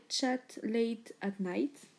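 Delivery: "Chat Late at Night" said slowly in a careful English accent; slow, effortful English accent on the shade name; attempted English accent. Real, not acted. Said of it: "Désolée pour mon accent anglais".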